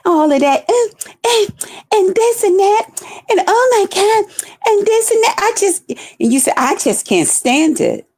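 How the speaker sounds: put-on fussy quote; mocking imitation. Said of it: "You talked about how I talk".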